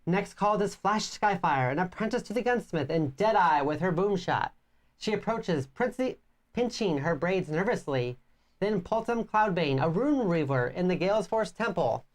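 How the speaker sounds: storybook reading voice; story narration. Acted. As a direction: read it like a fantasy tale. Reads game story narration.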